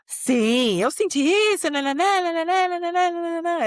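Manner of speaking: high, loud, rapid gush of babble; high-pitched, loud excited mimicry; imitating excited child. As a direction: mimic a child eagerly pouring it all out. Son eagerly answering in Spanish.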